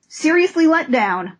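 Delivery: ordinary talk, nothing marked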